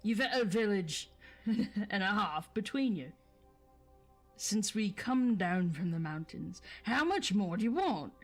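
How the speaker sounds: loud, scolding Cockney troll; William's troll accent. Acted. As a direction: tell him off in a rough Cockney troll voice. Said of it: "Forgive me for the accents".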